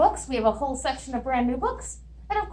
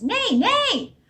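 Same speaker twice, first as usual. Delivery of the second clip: neighing horse; 10 semitones higher than usual. Acted. Librarian hears only horse sounds.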